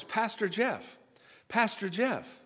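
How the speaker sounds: mock calling out from across the bar; high-pitched call across a room. Woman calling to the pastor from the bar, high call. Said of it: "comes a voice".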